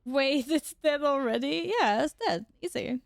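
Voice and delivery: normal talking voice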